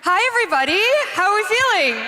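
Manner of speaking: high, projected hype call; crowd greeting. Real, not acted. Host greeting the convention crowd, excited calling out. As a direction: rouse the whole hall with a high-pitched hello.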